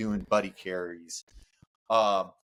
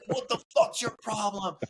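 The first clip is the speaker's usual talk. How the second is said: instructor yelling; 13 semitones higher than usual. Retold or imitated.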